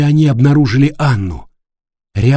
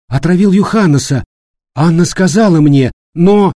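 frightened; 5 semitones higher than usual. Acted. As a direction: voice rising in alarm. Frightened realization about the maniac.

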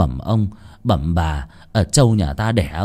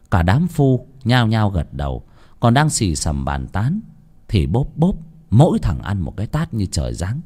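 ordinary delivery

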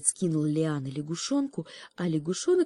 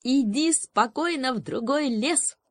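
triumphant; 8 semitones higher, 4 dB louder than usual. Acted. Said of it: "торжествовал свою победу лягушонок".

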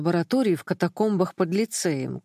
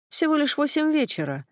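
higher-pitched, doubtful remark; 8 semitones higher than usual. A narrator's reading. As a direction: voice the friend's doubt with a raised pitch. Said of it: "с сомнением отозвалась Ксюха".

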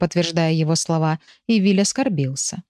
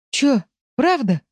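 higher, drawn-out astonished question; gasping surprise; 6 semitones higher, slower than usual. A narrator's reading. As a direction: marvel at unexpected news. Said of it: "ахнул Виль". (изумлённо ахнув)

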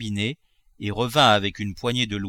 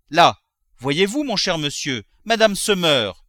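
raised, stretched-out exclamation; alarmed cry; 6 semitones higher, 5 dB louder than usual. A narrator's reading. Servant to master as madame faints, alarmed cry. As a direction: cry out that it is urgent. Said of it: "cria Nanon".

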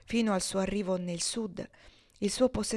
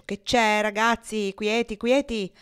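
guard's shout; 3 semitones higher, 7 dB louder, slower than usual. A narrator's reading. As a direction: yell to break up the brawl. Guard shouting at brawling men. (il custode grida per sedare la rissa)

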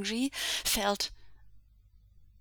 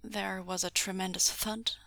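ordinary delivery, nothing marked

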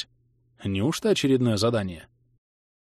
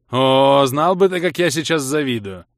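loud, raised wail of envy; plaintive howl; 4 semitones higher, 6 dB louder than usual. A narrator's reading. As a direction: lament loudly with envy. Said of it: "Жалобно взвыл Влас". (жалобно взвыть)

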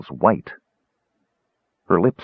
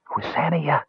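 whispered; 7 semitones higher, 6 dB quieter than usual. A narrator's reading. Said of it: "he whispered".

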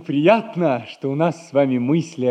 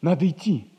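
normal talking voice

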